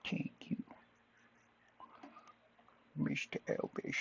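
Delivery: [name] impression. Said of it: "[name] impression voice".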